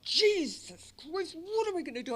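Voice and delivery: frantic despairing question; panicked plea. Retold or imitated. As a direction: plead in panic. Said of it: "but I went".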